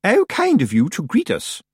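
high, formal grand lady; chilly politeness. A narrator's reading. Missus Earwig returns Granny's greeting, chilly politeness. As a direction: gush politely while meaning none of it. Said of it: "formal and chilly way".

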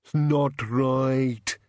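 very slow, drawn-out monster grumble; aggrieved creature mumble; mumbling bogeyman. Acted. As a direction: grumble it slowly as a beaten bogeyman. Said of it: "it mumbled".

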